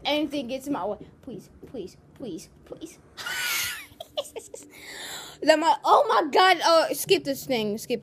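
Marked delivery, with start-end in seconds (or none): 1.2-3.0 soft pleading. Real, not acted. Begging game to let him through. 3.2-4.5 high laugh. Real, not acted. Laughs at his own pleading.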